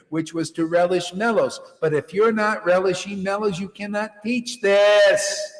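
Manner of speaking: loud, high sermon at full force; fiery preaching. Acted. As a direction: hammer it home to the crowd.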